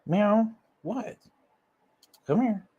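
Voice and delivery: high, soft coaxing to a pet; talking to the cat. Real, not acted. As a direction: coax the cat over gently. Calling the cat over.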